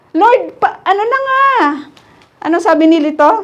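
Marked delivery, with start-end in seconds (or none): 0.1-1.4 lightly voiced impatient disciples, high; higher, fed-up disciples. Disciples grumbling to Jesus, mildly impatient. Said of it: "Sabi ng mga disciples".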